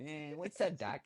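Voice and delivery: put-on tossed-off New York accent. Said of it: "that's a New York accent".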